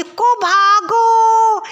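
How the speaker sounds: long drawn-out high yell of the name; old woman yelling. Acted. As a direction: call the daughter at the top of your lungs. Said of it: "कहते हुए चिल्लाती है". (बुढ़िया की ऊँची खिंची हुई पुकार)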